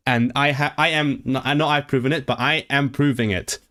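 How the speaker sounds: usual speaking voice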